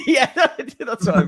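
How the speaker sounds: talking through real laughter; laughing. Real, not acted. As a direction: crack up at the tease while agreeing. Cracks up at Dracula remark.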